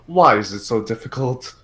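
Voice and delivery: normal talking voice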